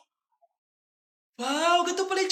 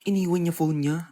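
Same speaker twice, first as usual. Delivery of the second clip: usual speaking voice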